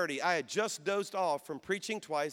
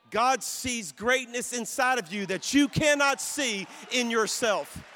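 louder, higher, slow and building preacher's cry; preaching at full force; 5 semitones higher, 6 dB louder, slower than usual. Real, not acted. Pastor preaching to young people, building into a loud cry. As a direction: lift the crowd, preach it hard.

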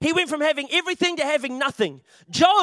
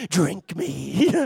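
coke bottle voice; 8 semitones lower than usual. Pretend. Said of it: "makes the sound that you want it to drink".

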